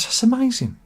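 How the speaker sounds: mildly hammed-up amazement, pitched high; high, swooping acted wonder; amazed customer. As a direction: play the impressed punter in a joke. Amazed customer in a told joke.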